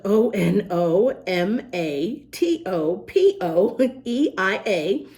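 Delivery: O N O M A T O P O E I A, each letter named; spelling out letters. Shown as a demonstration. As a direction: sound out each letter clearly for young listeners.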